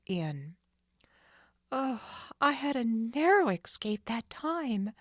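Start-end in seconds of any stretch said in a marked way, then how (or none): 1.7-4.9 put-on higher, breathless girl's voice; relieved Nancy. Nancy running away, relieved. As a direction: sigh of relief in words. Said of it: "the young sleuth told herself".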